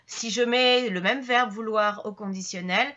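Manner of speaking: normal talking voice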